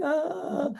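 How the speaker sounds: a faked little whimper-wail; mock wail.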